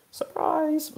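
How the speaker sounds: very high, drawn-out sing-song; mock surprise. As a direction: squeal it like a party reveal. Sing-song mock reveal after news.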